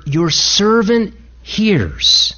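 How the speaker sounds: drawn out very slowly; slowed prayer. Acted. Preacher slowly drawing out the prayer of Samuel. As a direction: stretch the words out in reverence. Slow reverent prayer quote.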